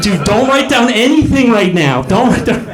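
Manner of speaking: high, strained pleading; pleading dying man. Pretend. Dying man begging someone not to record his words, high strained plea. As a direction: beg them to stop writing.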